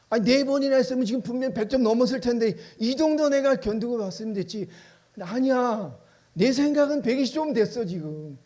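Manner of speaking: aggrieved believer pleading his case; indignant protest. Acted. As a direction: protest that you've done enough. Acted protest to God.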